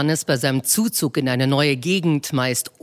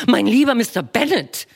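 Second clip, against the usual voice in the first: Mrs Bennet voiced high and bright-eyed, fussing; a high, eager matron gushing to her husband; eager wife; 7 semitones higher, 4 dB louder than usual. Wife to her husband with news, eager high voice. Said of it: "erwiderte seine Frau".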